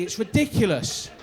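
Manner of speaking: ordinary talk, nothing marked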